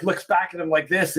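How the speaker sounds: ordinary delivery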